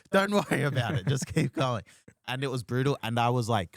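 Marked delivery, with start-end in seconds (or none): 0.1-1.8 higher, louder insistent brush-off; acted shout from the back; mock urgent reassurance. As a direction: urge the performer onward. Comics urge him to carry on.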